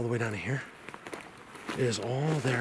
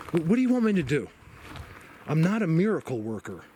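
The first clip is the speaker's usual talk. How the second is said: exasperated retort; 5 semitones higher than usual. Retold or imitated. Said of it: "It was like".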